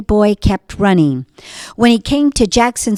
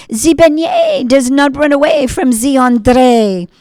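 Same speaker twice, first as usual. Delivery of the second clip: zany artist; 5 semitones higher, 3 dB louder than usual. Acted. Artist boasts.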